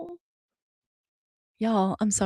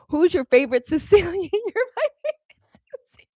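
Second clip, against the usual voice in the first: laughing; 8 semitones higher, 8 dB louder, faster than usual. Real, not acted. Playful teasing with laughter.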